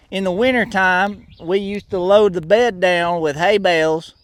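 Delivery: put-on proud good-old-boy with a Southern accent. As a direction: brag on the truck in a Southern accent.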